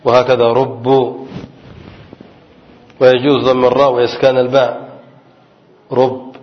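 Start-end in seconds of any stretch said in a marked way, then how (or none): 0.5-1.3 "ربو" drawn out slowly with a light single b; rubu, slow. Shown as a demonstration. Teacher repeats "ربو" slowly for students. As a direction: say "ربو" slowly, ru-bu without doubling. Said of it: "بالتخفيف".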